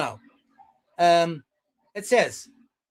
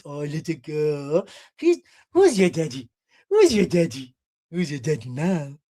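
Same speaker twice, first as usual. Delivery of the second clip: mocking baby-talk creep; creepy cooing man; 5 semitones lower than usual. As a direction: coo creepily as if to a small child. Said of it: "let me say it how he said it".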